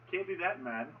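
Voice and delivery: usual speaking voice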